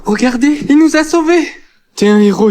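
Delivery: loud, high cheering; excited cheer. Acted. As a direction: hail the hero with joy. Crowd cheering the hero.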